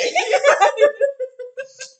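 shrieking laugh; loud cackle. Real, not acted. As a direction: burst out laughing loudly.

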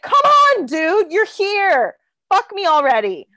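mildly exasperated plea, pitch lifting; exasperated urging. Retold or imitated. Retelling her plea to a stalling hookup, exasperated. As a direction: urge him along, fed up with the stalling.

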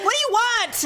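high, irritated demand; exasperated snap. Retold or imitated. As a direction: re-enact snapping at an interrupting husband. Retold snap at hovering husband.

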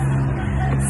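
short breathy laugh. Real, not acted. Fan just told of trading I love yous, fond laugh. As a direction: laugh out fondly without voicing it. Laughs while retelling sweet exchange.

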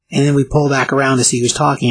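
ordinary delivery

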